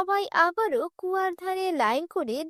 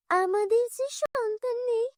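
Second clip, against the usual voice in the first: acted tearful voice, higher in pitch; tearful complaint; 7 semitones higher than usual. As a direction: confide a hurt as if close to tears. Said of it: "এভাবে কাঁদতে কাঁদতে".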